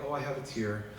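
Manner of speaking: softly mocking a small cry; mock dainty tear. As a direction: make light of a tiny tear. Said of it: "not like".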